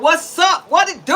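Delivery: loud, high street-tough challenge; Flint mode. Retold or imitated. Woman retelling a drive-thru clash, confrontational Flint mode. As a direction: hit it high and loud, spoiling for a fight. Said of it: "I went into straight Flint mode".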